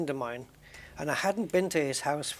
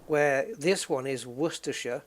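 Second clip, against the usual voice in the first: usual speaking voice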